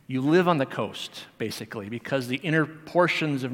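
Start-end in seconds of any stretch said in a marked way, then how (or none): none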